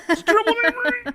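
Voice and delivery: high, fast put-on voice through laughter; laughing meme quote. Friend repeating the meme line while laughing, high voice. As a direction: echo the joke while cracking up. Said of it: "that's the way I'm crying".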